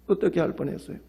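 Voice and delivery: hushed falling repeat with "어떻게" lightly stressed; soft echo. Acted. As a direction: murmur the question again to yourself.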